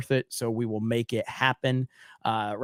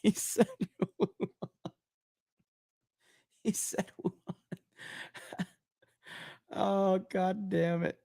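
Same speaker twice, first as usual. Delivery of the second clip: laughing; 5 semitones higher, 9 dB quieter than usual. Real, not acted. Laughing at viewer's comment.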